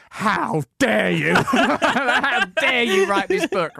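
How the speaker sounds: slow, high, hammed-up indignation; mock outrage. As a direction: imitate an affronted interviewer, drawing out each word. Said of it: "People were really angry".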